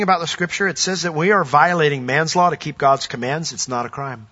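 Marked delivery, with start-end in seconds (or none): none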